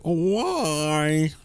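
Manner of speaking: imitating co-host's drawl. Host mocking how co-host says things, drawn-out mock drawl. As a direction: stretch the vowel in an exaggerated Southern imitation. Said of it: "Could you say things like".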